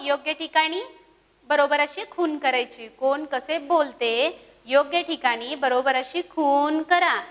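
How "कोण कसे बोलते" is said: slow title. Shown as a demonstration. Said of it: "title read again".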